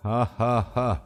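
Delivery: wild, ugly burst of laughter; menacing phone prank laugh, villain cackle; hideous cackle. Acted. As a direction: cackle like a madman to scare him. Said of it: "ایک بھیانک قسم کا بے ہنگم قہقہہ لگایا".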